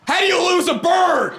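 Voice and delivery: very high-pitched mock shout; outraged boss. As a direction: shriek it in cartoonish outrage at an employee. Boss berates worker, acted.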